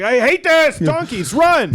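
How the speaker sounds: very high, loud shriek; high frantic shout; panicked yell. Acted. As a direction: scream at the donkeys to flee. Jesus in palm fight.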